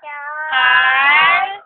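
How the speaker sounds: "Carl" loud and long, rising at the end; slow, loud model of the name; prompting. Shown as a demonstration. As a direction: stretch out "Carl" for a toddler to copy.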